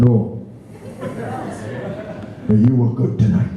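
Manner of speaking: put-on a light Welsh lilt, an old man's gentle reply; lightly voiced elderly Welshman in a Welsh accent. Welsh pensioner answering the singer after the show, in a mild Welsh accent. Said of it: "Now that is a Welsh accent".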